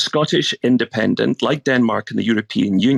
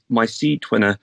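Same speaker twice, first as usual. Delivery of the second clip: ordinary talk, nothing marked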